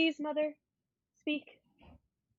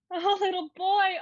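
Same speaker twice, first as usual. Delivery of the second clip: laughing delight; 7 semitones higher, 3 dB louder than usual. Acted. Daughter hears of the new baby, laughing with delight. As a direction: laugh through it, higher and louder.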